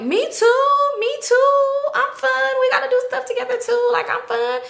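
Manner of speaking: high, loud eager copycat voice; imitating Clingy. Clingy begging to be included, high eager voice. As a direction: squeak it out like a clingy hanger-on.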